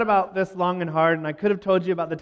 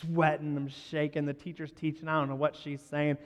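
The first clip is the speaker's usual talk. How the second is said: much softer, nervous inner voice; hushed inner thoughts; 4 semitones lower, 10 dB quieter than usual. Retold or imitated. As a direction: think it to yourself, hushed. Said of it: "and I'm like".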